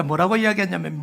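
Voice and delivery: ordinary talk, nothing marked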